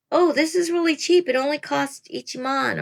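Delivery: bright high exclamation, mildly acted; excited quote. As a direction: gush about a bargain, a touch hammed-up. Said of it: "So when you're saying".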